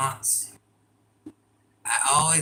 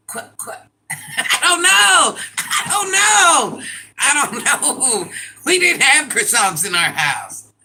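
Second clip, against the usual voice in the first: exasperated laughing wail; 10 semitones higher, 8 dB louder than usual. Real, not acted. Streamer defeated by a hard word, laughing wail. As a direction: wail it helplessly through laughter. Gives up on pronouncing, cracking up.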